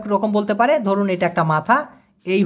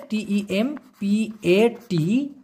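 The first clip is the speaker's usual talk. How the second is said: spelling slowly; 5 dB quieter, much slower than usual. Shown as a demonstration. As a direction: spell out each letter slowly. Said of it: "উচ্চারণটা হবে".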